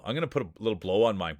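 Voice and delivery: normal talking voice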